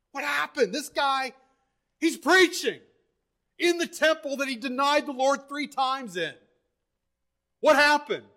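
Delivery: high, incredulous preaching exclamation; astonished, punching out each question. Real, not acted. Preacher astonished at the changed man.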